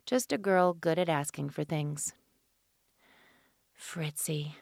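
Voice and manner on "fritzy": soft unvoiced whisper of the name. A narrator's reading. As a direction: breathe the name.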